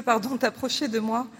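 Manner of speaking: ordinary talk, nothing marked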